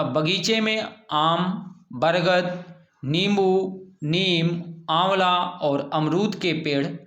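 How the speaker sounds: slow list, clear pause after each comma; pausing at commas. Shown as a demonstration. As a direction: read each tree name as its own item. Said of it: "इन नामों को अलग अलग करने के लिए अल्प विराम". (हर अल्प विराम पर रुककर धीरे पढ़ें)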